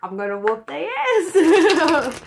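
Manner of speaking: loud, slow, laughing threat. Real, not acted. Reader laughing as she threatens the user. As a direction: threaten them while cracking up. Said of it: "I'm mad".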